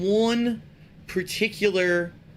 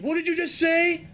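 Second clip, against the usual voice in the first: higher accusing teacher; 9 semitones higher than usual. Retold or imitated. Teacher repeats her question to the pupil, higher. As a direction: snap the question like a suspicious teacher. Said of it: "And she said".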